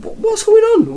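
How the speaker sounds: high, incredulous; baffled. Real, not acted. Vlogger reacting to the coincidence, baffled. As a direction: ask it in disbelief. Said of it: "why is he turning up".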